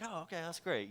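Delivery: quiet, deflated "that's great"; deflated reply. Retold or imitated. Someone just outdone by a topper, quietly deflated. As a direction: let the air out, soft and unimpressed.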